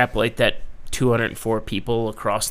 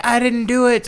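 innocent denial; 12 semitones higher, 6 dB louder than usual. Retold or imitated. Ganesh voiced.

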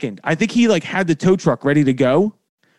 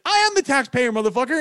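mock indignant yell; 9 semitones higher than usual. Yelling at impound clerk.

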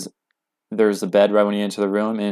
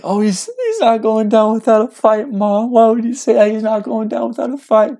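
high, panicked retold outburst; distraught teen pleading with mom; frantic pleading; 12 semitones higher, 4 dB louder than usual. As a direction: raise the pitch and protest in alarm.

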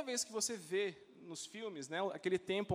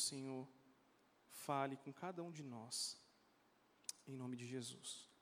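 prayer; 3 dB quieter than usual. Acted. Leads the congregation in prayer.

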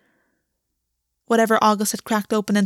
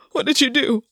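higher, broken plea; cracked and broken; 4 semitones higher than usual. A narrator's reading. Gwen pleading with August on the bathroom floor, cracked and broken. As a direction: force it out, close to sobbing. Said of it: "cracked and broken".

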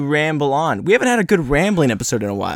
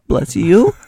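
puzzled, louder bless you; puzzled question; 5 semitones higher, 5 dB louder than usual. Retold or imitated. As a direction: ask it unsure, rising. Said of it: "I'm like".

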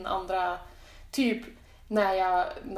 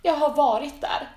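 higher, louder mock protest; indignant protest; 5 semitones higher, 8 dB louder than usual. Speaker replays correcting the Östersund assumption, mock indignant. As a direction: insist on it, mock-offended. Denies having the dialect, acted quote.

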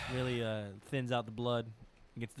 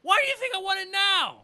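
high, loud fed-up shout; exasperated shout; 13 semitones higher, 6 dB louder than usual. Acted. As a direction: yell it out in mock exasperation. Mock exasperated rant.

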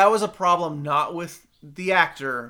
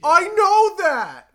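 mock voice; 9 dB louder, much slower than usual. Mock voice continues.

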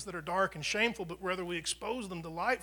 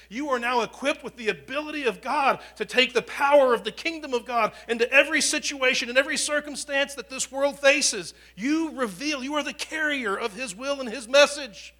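louder, higher, driving sermon voice; preaching at full force; 5 semitones higher, 8 dB louder than usual. Acted. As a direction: build the congregation up with fire. Preaching climax.

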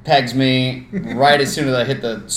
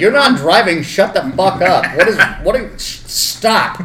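raised, higher voice, exasperated; irritated retort; 5 semitones higher, 4 dB louder than usual. Retold or imitated. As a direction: snap back at a nagging sister. Retold snapping at sister.

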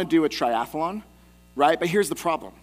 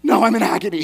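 higher, louder hammed-up suffering; mock agony; 4 semitones higher, 9 dB louder, faster than usual. Dad answering his worried daughter, exaggerated agony. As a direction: cry it out in comic pain. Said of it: "I'm like".

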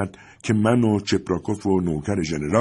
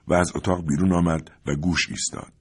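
plain reading voice

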